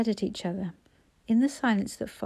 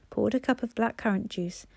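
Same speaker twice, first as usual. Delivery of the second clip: usual speaking voice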